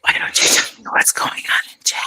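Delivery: ASMR-style whisper. Real, not acted. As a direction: whisper into the mic. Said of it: "the way that we're whispering into our mics".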